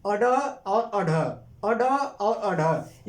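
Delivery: अड और अढ said very slowly twice; very slow drilled अड और अढ; slow pronunciation drill. Shown as a demonstration. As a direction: stretch out अड और अढ so the class can follow. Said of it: "क्या कहेंगे?".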